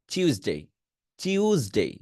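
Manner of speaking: British টিউসডে as "chyoo-day" with চিউ. Shown as a demonstration. Teacher modelling the British চিউ টিউসডে. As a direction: glide চিউ into ডে. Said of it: "ব্রিটিশরা টিউসডে না বলে আবার চিউ বলে".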